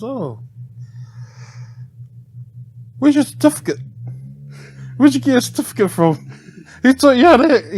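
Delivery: high, loud, indignant scolding; mock scolding customer.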